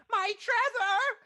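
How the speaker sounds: put-on high, slow, laughing old lady voice; quavering granny calling out slowly; very old woman. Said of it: "a frail old woman that's like".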